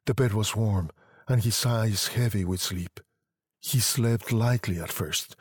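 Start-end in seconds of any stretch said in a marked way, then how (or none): none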